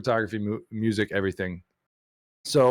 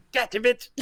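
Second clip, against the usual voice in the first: much higher mock Chappelle yelp; Dave Chappelle; 12 semitones higher than usual. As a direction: do the Chappelle gotcha line. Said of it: "Dave Chappelle thing where he's like".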